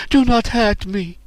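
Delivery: put-on high, pleading girl's voice; high begging voice, slowing; crying plea. A narrator's reading. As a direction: beg desperately, pitched high. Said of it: "cried the Hinrabian".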